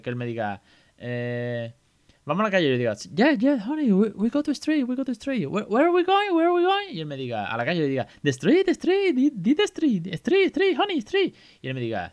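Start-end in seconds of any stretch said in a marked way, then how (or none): 3.1-6.9 very high-pitched, over-eager parent coaxing in English; hammed-up singsong prompting, pitched way up; exaggerated English-speaking parent. Parent nudging child to name the street, exaggerated. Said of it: "lo he exagerado". 8.2-11.3 shrieky-high, louder parent repeating the word eagerly; exaggerated prompting. Parent correcting child into English, exaggerated. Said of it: "lo he exagerado".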